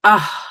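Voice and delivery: "ah" with a strong breath before it; exaggerated breathy H. Shown as a demonstration. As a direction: push air hard before "ah". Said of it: "as exaggerated as I possibly can".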